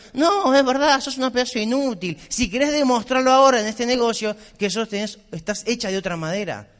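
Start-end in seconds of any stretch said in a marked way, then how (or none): none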